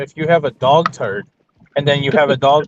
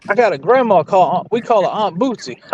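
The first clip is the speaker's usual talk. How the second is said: talking like Ricky; 9 semitones higher than usual. Retold or imitated. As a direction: spin a down-home yarn as Ricky. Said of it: "that's all you gotta do to be Ricky".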